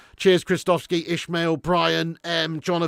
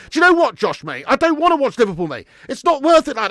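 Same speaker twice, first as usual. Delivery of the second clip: high, loud, fed-up protest; exasperated refusal; 8 semitones higher, 7 dB louder than usual. Real, not acted. Streamer turning down viewer's fifty quid, loud exasperated protest. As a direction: push back loudly with pitch jumping up.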